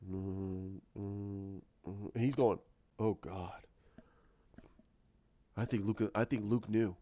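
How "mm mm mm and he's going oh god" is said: anguished moaning. Retold or imitated. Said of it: "that's when you hear the".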